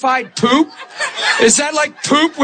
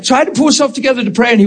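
ordinary delivery

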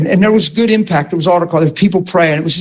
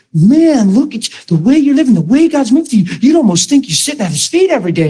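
admiring exclamation; 6 semitones higher than usual. Retold or imitated. Onlooker marvels at a changed believer, excitedly. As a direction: gush like an impressed onlooker.

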